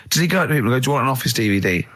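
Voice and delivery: ordinary delivery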